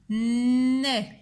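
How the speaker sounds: "nez" with a long held n; stretched syllable. Shown as a demonstration. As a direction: lean on the opening n of "nez". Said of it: "j'entends le son n".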